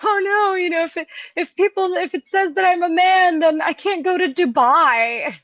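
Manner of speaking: high, whiny mock sob about Dubai; mock crying. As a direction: whine it high like a mock crybaby. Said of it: "crying because".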